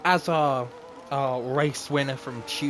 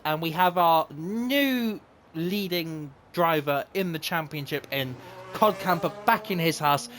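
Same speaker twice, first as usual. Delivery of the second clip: upbeat host introducing the grid; race announcer; 5 semitones higher than usual. Acted. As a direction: welcome viewers like a broadcaster. Opens league race broadcast.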